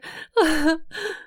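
high choked sob; a sob breaking out of her; girl sobbing into her beloved's shoulder. Acted. Sob after confession.

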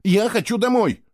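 high, loud insistence; boy insisting he wants home. Acted. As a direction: dig in and insist. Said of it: "настаивал на своём Фло".

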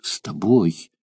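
soft hushed vow; whisper. Acted. Dying Mirtsa softly vows to her lover, hushed. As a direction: breathe the words against him. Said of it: "шептала она".

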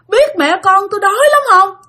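high mournful wail; crying ghost. Retold or imitated. Ghost mother begging the sleeper for food, in a high tearful wail. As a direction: moan the complaint like a weeping spirit. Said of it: "đang khóc".

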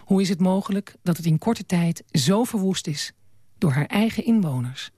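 measured literary reading with deliberate pauses; story narration. A narrator's reading. Leest voor uit boek. (verhalend voorlezen, beheerst en met pauzes)

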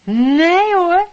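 surprised no. Acted. Correcting the job title.

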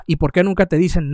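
ordinary talk

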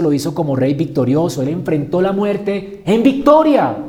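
loud, proclaiming sermon voice; forceful preaching. Acted. (predicación enérgica y en voz alta)

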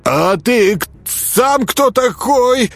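high, stammering comeback; quavering attempt to stand your ground; falter, then stretch it out high and shaky; trembling voice. A narrator's reading. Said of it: "дрожащим голосом попытался не уступить".

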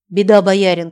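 loud alarmed cry; shouting. Acted. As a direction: call out the warning loudly. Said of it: "крикнула она".

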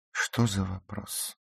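high, drawn-out indignant question. A narrator's reading. Ruth bristles at the narrator's question, indignant. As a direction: take offence at being asked. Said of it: "возмутилась она".

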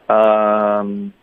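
usual speaking voice

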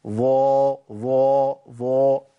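ظا said with lips pushed into a hint of u; overrounded ẓa. Shown as a demonstration. A teacher demonstrates the wrong over-rounded ظا. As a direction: overdo the rounding on ظا. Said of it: "اديتها ريحة ضم".

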